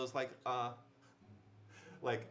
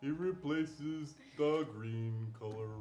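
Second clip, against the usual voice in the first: character voice; 3 dB louder, slower than usual. Pretend.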